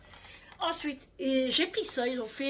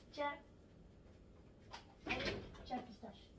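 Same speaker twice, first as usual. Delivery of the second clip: soft words to the dog; 16 dB quieter than usual. Real, not acted. Giving dog a treat.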